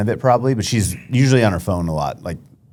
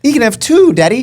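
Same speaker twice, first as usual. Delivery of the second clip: put-on high, pleading child voice; little girl voice; 13 semitones higher, 4 dB louder than usual. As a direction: play the eager daughter begging. Voicing daughter asking for horse.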